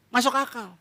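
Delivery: usual speaking voice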